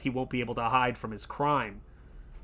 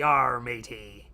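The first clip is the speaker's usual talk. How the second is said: slow, exaggerated pirate accent; slower than usual. Host demos the famous pirate accent, slow pirate accent. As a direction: growl it out like a stage pirate, slowly. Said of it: "pirate accent".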